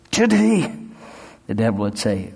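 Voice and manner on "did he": high, loud pressing question; demanding challenge. Retold or imitated. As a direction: push the devil for an answer. Challenging the devil, retold.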